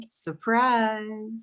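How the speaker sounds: usual speaking voice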